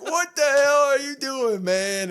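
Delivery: high, loud retold outburst; mock yell. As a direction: shout it like an exasperated big man. Damian Priest yelling at Dom.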